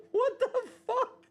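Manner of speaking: shrieking laugh into a stunned question. Real, not acted. Streamer cracking up at kidney prices, laughing. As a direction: burst out laughing in disbelief.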